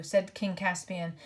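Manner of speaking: ordinary delivery, nothing marked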